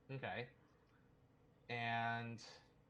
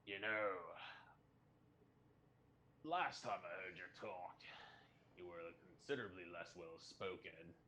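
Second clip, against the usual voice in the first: measured, wry prisoner; 5 semitones lower, 7 dB quieter than usual. Acted. Player voices Anon to Orc.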